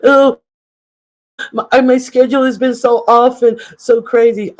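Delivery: high, loud frazzled mimicry; hammed-up frazzled whine; mocking frazzled quote. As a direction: wail it like she's overwhelmed. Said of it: "but then she said".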